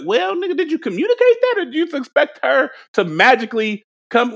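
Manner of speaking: high, disbelieving squeal at a friend; incredulous mock scolding. Telling a homie he never said what he wanted, in disbelief. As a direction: shriek the question like he can't be serious.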